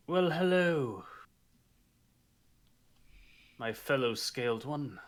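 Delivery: lofty, grand noble hello; a touch of a pompous noble; pompous greeting. Acted. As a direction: greet her with overdone airs. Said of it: "Who the hell talks like that?".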